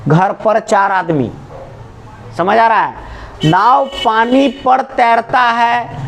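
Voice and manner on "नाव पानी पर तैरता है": very slow, stretching every word; slow teaching demo. Shown as a demonstration. Teacher slowly modelling पर for the class. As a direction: draw it out for the class. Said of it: "क्या difference है बताओ".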